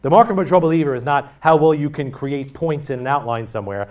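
ordinary delivery

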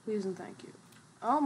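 usual speaking voice

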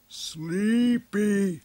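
high, loud, stretched-out word; creature's thought. Acted. Tila senses the fed creature's thoughts, high and drawn out. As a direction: draw it out as a drowsy beast's high call. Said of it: "from its thoughts".

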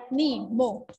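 usual speaking voice

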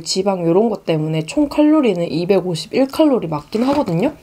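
ordinary talk

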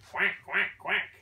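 playful duck quacks. Pretend. Duck sound for a child.

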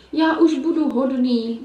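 slow, pleading, half-sobbed promise; a big wolf whimpering he'll be good; sobbing wolf. Acted. Wolf caught crying to the girls, sobbing slowly. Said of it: "vzlikal tam vlk".

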